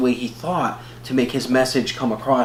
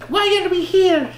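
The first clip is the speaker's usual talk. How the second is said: very high girlish impression; imitating the sister; 4 dB louder than usual. Sister complaining about the building, high-pitched mimicry. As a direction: mimic her in a high squeal. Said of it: "like, what she sounds like".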